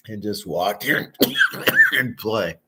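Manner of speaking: throat scratchy from nose plugs; wearing nose plugs. Real, not acted. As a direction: talk through a tickly throat with nose plugs. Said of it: "my nose plug causing my throat".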